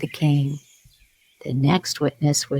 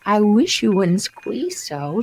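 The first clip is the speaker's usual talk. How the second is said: Dormouse voice; 6 semitones higher than usual. Acted. Dormouse complains, squeezed.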